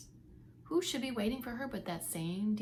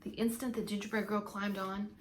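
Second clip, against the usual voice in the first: plain reading voice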